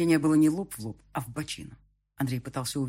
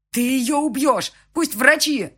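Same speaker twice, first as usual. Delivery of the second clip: much higher, much louder, slow panicked yelling; panicked scream; 11 semitones higher, 10 dB louder, slower than usual. A narrator's reading. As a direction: scream at him in terror. (истошный крик)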